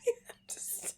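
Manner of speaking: breathless whispered plea. Real, not acted. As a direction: whisper it through laughter. Whispered while laughing helplessly.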